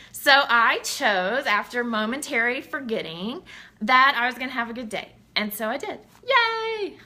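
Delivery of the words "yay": high, drawn-out hooray; little cheer. Real, not acted. Celebrating the choice to have a good day, gleeful.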